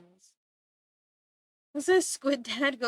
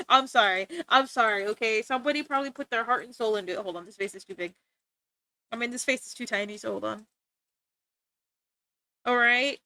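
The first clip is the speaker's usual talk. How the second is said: usual speaking voice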